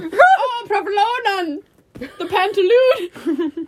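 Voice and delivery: high, loud theatrical wail; over-the-top operatic lament; mock dramatic cry. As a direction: cry out in hammed-up horror, drawing out the last word. Mock theatrical exclaiming over spilt cheese.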